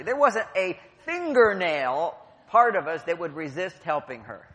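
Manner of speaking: ordinary talk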